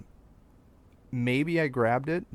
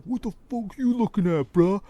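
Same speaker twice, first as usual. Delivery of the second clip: high mock thug voice, much higher; tough arrestee; 10 semitones higher, 5 dB louder than usual. Imagined arrestee glaring at him in the police station, mock tough sneer. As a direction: sneer it like a tough guy in lockup. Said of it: "arrestees ... sitting there next to me like".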